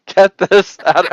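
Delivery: high, loud, drawn-out mock outrage; swearing wife. Wife ordering the centerpiece out of the house, mock angry. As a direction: play an irate wife ordering it out, slowly. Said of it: "she'll probably start swearing".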